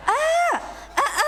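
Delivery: high-pitched, playful call to a husband; wife calling husband. Acted. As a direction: call him like a wife at home. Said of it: "nyauran caroge kumaha".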